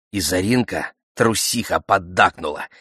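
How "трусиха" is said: ordinary delivery, nothing marked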